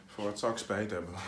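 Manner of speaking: ordinary delivery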